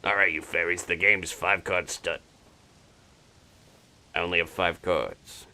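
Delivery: lightly voiced old-timey mobster fairy; a hint of a card-dealing gangster. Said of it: "That's my fairy voice".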